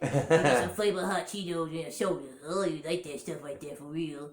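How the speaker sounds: congested cat character voice; post-nasal drip cat. Acted. Voicing mom's cat with post-nasal drip, congested character voice. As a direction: talk like a stuffed-up cat with attitude. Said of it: "he talked like this".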